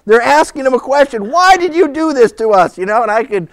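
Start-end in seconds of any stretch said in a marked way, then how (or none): 1.3-2.8 raised, higher-pitched reproach; exasperated parent. Acted. Parents questioning their runaway son, acted exasperation. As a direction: act out scolding a child.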